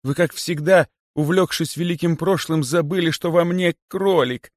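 high-pitched anxious explanation; pleading. A narrator's reading. Kosoy explains himself to the great python, pleading. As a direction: plead your case in a high voice. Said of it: "взмолился Косой".

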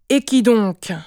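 loud, stern father's question. A narrator's reading. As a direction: demand the culprit's name. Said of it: "demanda Richard d'un ton sévère". (ton sévère)

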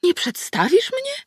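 high, slow, mock-hurt question; offended goddess. A narrator's reading. Goddess asks to be introduced, pitched high and hurt. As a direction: play wounded pride. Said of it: "zapytała z urażoną miną".

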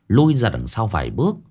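usual speaking voice

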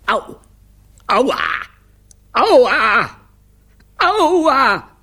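high, loud howls of pain; pained yelps. Acted. Director bitten by four children, escalating pained yelps.

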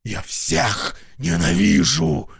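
loud high rasping fury; raging hoarse shout. Acted. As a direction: scream your hatred at God. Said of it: "прохрипел". (хриплый яростный крик)

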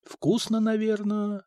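high, soft, wistful musing; imagine the taste, softly and longingly; dreamy. A narrator's reading. Mikha imagines the tavern's stew, dreamy and soft. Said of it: "мечтательно протянул Миха".

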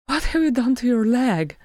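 high-pitched, alarmed wifely question; breathless. A narrator's reading. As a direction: ask it in breathless concern. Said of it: "she said breathlessly".

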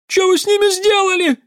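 very high, loud shriek; woman's shriek. A narrator's reading. Woman in the crowd accuses them, shrieking. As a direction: shriek it in outrage. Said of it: "взвизгнула женщина".

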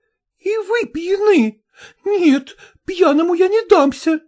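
high, outraged woman's voice; indignant refusal. A narrator's reading. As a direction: refuse him hotly, pitched well up. Patient refuses the drunken medic.

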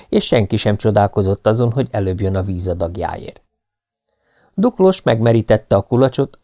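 ordinary delivery

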